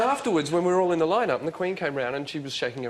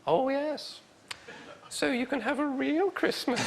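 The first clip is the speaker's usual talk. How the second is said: much higher, softer, slow Queen voice; sly royal jab, pitched way up; imitating the Queen; 12 semitones higher, 5 dB quieter, slower than usual. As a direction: deliver the punchline high and slow as the Queen. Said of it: "she went".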